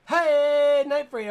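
high, loud hey to grab attention; calling out. Real, not acted. Host calls out to the absent winner, loud and high. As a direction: shout out to get him to answer.

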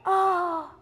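high, loud, long "oh"; relieved sigh. Acted. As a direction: let out a big blissful oh. Man relishes the quiet at last.

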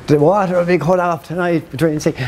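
high, far-off mock street call; town crier. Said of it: "you started shouting out".

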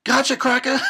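high, loud gleeful yell; hammed-up gotcha shout; killer's shout. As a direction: burst in with a mock attack cry. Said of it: "He's like".

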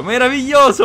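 high, loud delight; excited. Real, not acted. Gleeful gaming.

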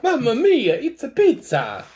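hammed-up Italian accent; cheerful Italian chef exclamation; jolly italian pizza chef. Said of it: "Mario named, then Italian exclamation".

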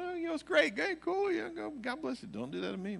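high, falsely cheerful brush-off; mock enthusiasm. Pastor mimicking himself at worship, mock-cheerful. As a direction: fake a chipper approval while backing away. Mocking his own polite reaction.

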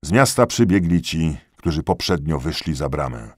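ordinary delivery, nothing marked

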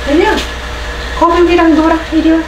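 high, loud coaxing to a pet. Real, not acted. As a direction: call the dog up high. Coaxing dog to play.

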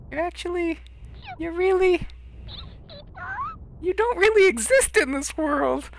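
high, halting character voice; hesitant confessing companion. Acted. Companion telling Klonoa the hard truth, high and halting.

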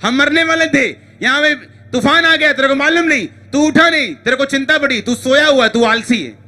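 high-pitched, insistent reproach in a run of short bursts; reproachful complaint. Acted. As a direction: complain urgently to someone who slept through the danger. Voices disciples complaining to sleeping Jesus.

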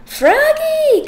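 high loud call across the house; dad calling his son loudly. Acted. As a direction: call him from the other room. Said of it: "called his dad".

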